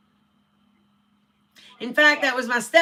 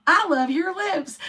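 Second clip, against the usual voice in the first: higher, slow, teasing repeat; mock repeating; 7 semitones higher, slower than usual. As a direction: push it up high like a broken record. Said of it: "he's continually repeating it".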